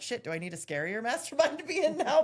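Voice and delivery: high, loud joking protest; mock alarm. Mock panic at outgrowing the group.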